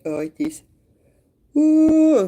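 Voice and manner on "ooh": a squealed ooh, high and loud; sudden yelp of realisation. Real, not acted. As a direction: break off with a shrill-sounding ooh. Self-interrupting exclamation.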